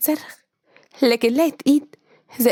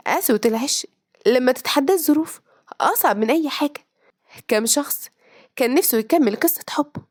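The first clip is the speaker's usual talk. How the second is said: ordinary delivery, nothing marked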